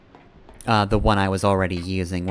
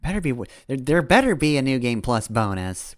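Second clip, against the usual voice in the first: ordinary talk, nothing marked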